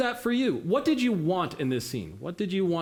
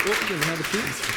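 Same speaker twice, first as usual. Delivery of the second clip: usual speaking voice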